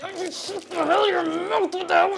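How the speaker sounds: slow, high urgent protest; hissed mock protest. Streamer retelling hiding from the preacher, slow high protest. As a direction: hide and scold the housemate. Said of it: "And I was like".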